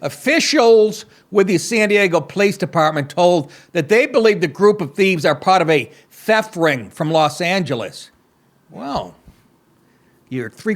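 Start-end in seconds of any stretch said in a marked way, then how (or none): 0.0-8.1 mock anchor reading the story; deadpan read of the news copy. Host reading the heist story to listeners, mock news read.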